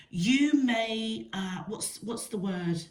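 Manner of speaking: ordinary talk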